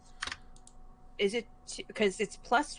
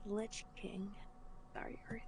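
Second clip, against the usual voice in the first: whisper; 12 dB quieter than usual. Real, not acted. Whispered aside at table.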